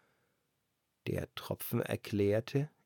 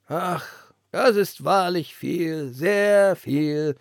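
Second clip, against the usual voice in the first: put-on higher, louder, slow amazed old lantern; 6 semitones higher, 9 dB louder, much slower than usual. A narrator's reading. The old lantern amazed at the wind's gift. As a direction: marvel at the gift, drawn out.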